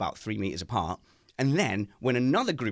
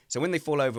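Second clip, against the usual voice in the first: ordinary talk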